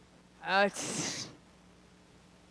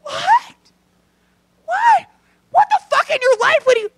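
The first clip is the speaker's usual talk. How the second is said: shrill, loud incredulous What; disbelief; 9 dB louder than usual. Real, not acted. Comic reacts to canned air, loud and incredulous. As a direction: shriek it in total disbelief.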